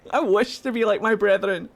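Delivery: ordinary talk, nothing marked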